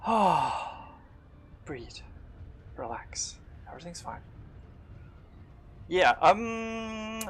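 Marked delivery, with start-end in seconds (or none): 1.6-2.0 quiet self-soothing. Real, not acted. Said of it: "I'm literally sweating right now". 2.8-3.4 softer and lower, breathed to himself; soothing himself after the scare; whispered relax. Real, not acted. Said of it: "I'm literally sweating right now". 3.7-4.2 much softer, almost breathed; hushed reassurance after the near loss; soft self-reassurance. Real, not acted. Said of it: "I'm literally sweating right now".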